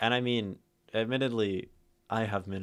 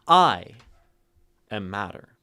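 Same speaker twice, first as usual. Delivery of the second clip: I set apart, far louder than the rest; stress on I; 10 dB louder than usual. Shown as a demonstration. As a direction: punch I and let it stand alone. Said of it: "four ways to read it".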